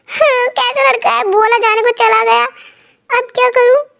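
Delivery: put-on a miffed young woman muttering after he walks off; flustered heroine. Heroine left alone, flustered.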